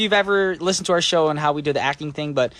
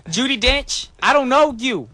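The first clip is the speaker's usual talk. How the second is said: mock confrontation; 7 semitones higher, slower than usual. Playful threat to absent actress.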